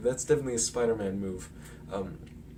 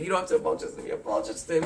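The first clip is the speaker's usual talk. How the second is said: higher put-on aunt voice, scolding; a hint of a stern aunt; aunt correcting Peter; lightly voiced aunt; 5 semitones higher, 3 dB louder, much faster than usual.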